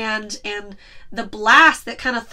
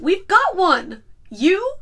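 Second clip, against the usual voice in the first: incredulous mock quote; 6 semitones higher, slower than usual. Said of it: "And then".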